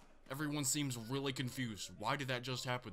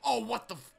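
shocked outburst; 9 semitones higher, 4 dB louder than usual. Real, not acted. Sudden shock mid-commentary.

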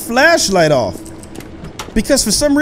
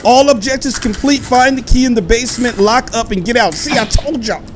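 normal talking voice

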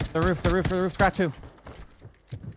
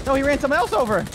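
excited; 8 semitones higher than usual. Real, not acted. Watching a car run someone over.

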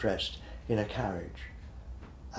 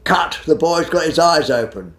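loud, high call to stop the take; retold shout; 7 semitones higher, 11 dB louder than usual. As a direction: bark it across the set like a cameraman. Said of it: "the cameraman shouted".

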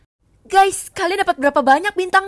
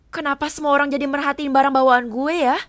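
usual speaking voice

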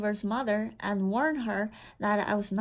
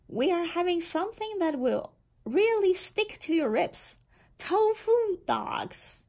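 gleeful announcement; 7 semitones higher than usual. Acted. Oliver gleefully announces the menu.

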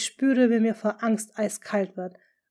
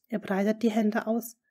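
ordinary delivery

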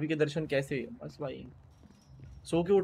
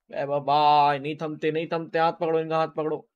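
loud, excited cheering on; hype shout-out; 2 semitones higher, 8 dB louder than usual. Real, not acted. Streamer cheering a spamming viewer, loud hype. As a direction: hype him up at full volume.

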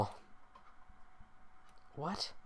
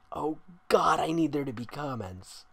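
ordinary delivery

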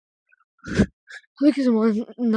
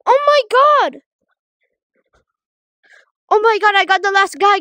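excited; 8 semitones higher than usual. Real, not acted. Got the last guy.

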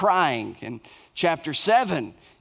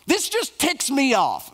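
slow, higher, exasperated grumble; ticked off; 8 semitones higher, slower than usual. Retold or imitated. Preacher voicing Jeremiah fed up with God, slow annoyed outburst. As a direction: stretch out the irritation word by word. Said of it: "got ticked off".

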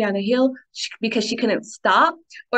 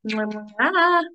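smacking "mwah" kiss sounds; affectionate kiss noise; blown kisses. Real, not acted. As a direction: blow the listeners two kisses. Blowing kisses to sign off.

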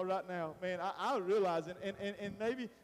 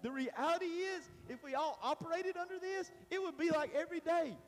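excited; 7 semitones higher than usual. Real, not acted. Excited preaching build.

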